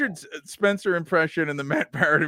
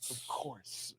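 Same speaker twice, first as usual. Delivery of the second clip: imitating Spencer; 3 semitones lower, 22 dB quieter than usual. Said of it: "He'll just be like".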